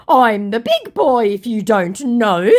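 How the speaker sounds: put-on high, slow, loud childish boast; hammed-up big-boy bragging, drawn out; mock boastful scammer. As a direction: puff it up like a mocked braggart. Acting out scammer's boastful message.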